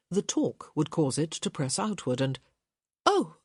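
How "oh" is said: sudden high yelp of surprise; startled gasp. A narrator's reading. Hyacinth startled as the tile pops loose, a high yelp. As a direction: jump at the tile popping out.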